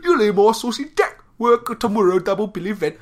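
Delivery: loud, high mock-Cockney reading; Cockney accent. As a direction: quote it in an exaggerated Cockney voice. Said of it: "I like your Cockney accent".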